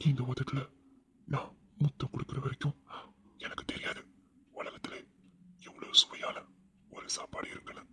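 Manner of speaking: low, breathy romantic whisper; seductive whisper. Acted. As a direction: breathe it close to her ear. Husband's seductive whispered confession. (காதல் கிசுகிசுப்பு)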